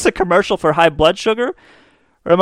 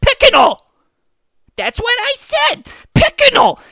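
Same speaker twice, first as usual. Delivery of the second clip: put-on smug kid voice; 5 dB louder than usual. Ad kid insisting he said it right, shrill kid squeak. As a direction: squeal it like a smug little brat. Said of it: "kids ... with the high voices".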